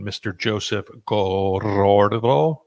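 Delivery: rolled Spanish name, said slow; Spanish accent. Pretend. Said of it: "I tried to say that in my best Spanish accent".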